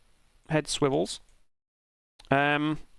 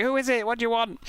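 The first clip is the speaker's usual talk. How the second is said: neighbour at window; 7 semitones higher than usual. Retold or imitated. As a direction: call down from the window, a bit startled.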